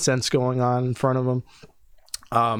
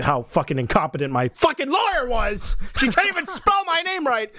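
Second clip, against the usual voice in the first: exasperated rant; 11 semitones higher than usual. Real, not acted. Ranting about his lawyer.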